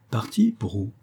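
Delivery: sudden astonished echo of the maid; surprised. Acted. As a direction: snap back in disbelief. Master hears the butler has gone.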